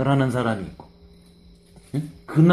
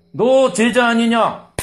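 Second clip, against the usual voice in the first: much higher, louder, slow accusation; pointed accusation; 10 semitones higher, 7 dB louder, much slower than usual. Acted. Servant accusing Peter, higher, louder and slow. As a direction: corner him with the question.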